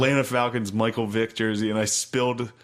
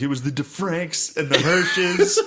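laughing whine; 7 semitones higher, slower than usual. Retold or imitated. Teen blaming others to mom.

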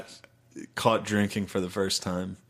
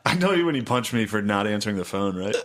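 ordinary talk

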